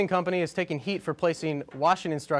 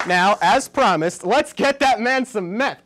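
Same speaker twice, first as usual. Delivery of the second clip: loud, slow, raised-pitch proclamation; hyped announcement; 5 semitones higher, 7 dB louder, slower than usual. Pretend. Host reads out a cue card to the crowd, hyped announcement.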